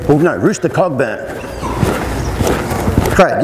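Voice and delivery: usual speaking voice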